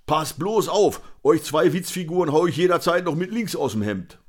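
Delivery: high, loud blustering rant; acted cantankerous tough guy getting heated; worked-up threat. A narrator's reading. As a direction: threaten them with cocky, belligerent bluster. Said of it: "Conny kam jetzt richtig in Fahrt".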